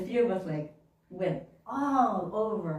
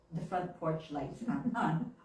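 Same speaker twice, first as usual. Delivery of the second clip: ordinary delivery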